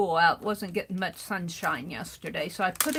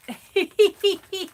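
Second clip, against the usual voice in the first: delighted high laugh; 10 semitones higher, 7 dB louder than usual. Real, not acted.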